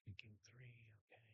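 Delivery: barely audible, thinking to oneself; very soft private mumble mid-thought; whispered aside. Real, not acted. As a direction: drop to a whisper, as if talking to yourself. Quiet aside to self mid-talk.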